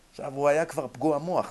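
ordinary talk, nothing marked

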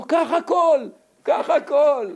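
high, pleading mock panic; frightened plea. Shopkeeper begs tattooed customer, high frightened panic. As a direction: beg him to take everything, scared. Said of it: "הוא מרוב פחד".

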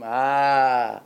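long sigh of relief; relieved "ahh". Shown as a demonstration. Father showing the comfort after cold water, relieved sigh. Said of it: "אחר כך אומרים".

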